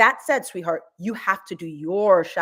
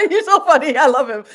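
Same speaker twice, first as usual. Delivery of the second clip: laughing; 4 semitones higher, 10 dB louder than usual. Real, not acted. Laughing delight.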